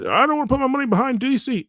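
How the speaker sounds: quick, high mocking voice of a doubter; mock whiny critic. Host mimics community critics in a quick, high mocking voice. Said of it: "but it's, it's a".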